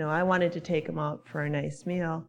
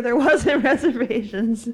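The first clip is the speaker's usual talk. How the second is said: retold reply breaking into higher, louder laughter; laughing retort; 5 semitones higher, 5 dB louder than usual. Retelling her answer to the restaurant host, laughing. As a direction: laugh through the retold comeback.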